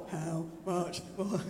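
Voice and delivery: low, slow and soft; half-hearted mock delivery. Shown as a demonstration. Preacher showing congregation how not to say it, half-hearted. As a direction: drag it out limply, without energy.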